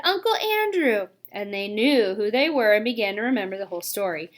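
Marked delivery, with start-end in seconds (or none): 0.0-1.1 high shout of sudden recognition. A narrator's reading. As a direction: call the name out as memory hits. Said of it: "he shouted".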